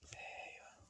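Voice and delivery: whispered to himself; a quiet satisfied whisper at the easel. Real, not acted. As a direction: breathe it out under your breath. Artist murmuring while drawing.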